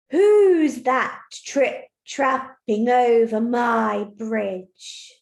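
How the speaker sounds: slow, loud, booming troll demanding who crosses. Shown as a demonstration. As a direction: roar it out slowly like a big angry troll. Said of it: "the first speech is said by the troll".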